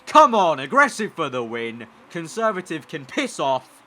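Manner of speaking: loud, gloating cheer; excited shout. Real, not acted. Streamer gloating that aggressive strategy won, loud cheer. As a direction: crow about the bold strategy paying off. Celebrating the win.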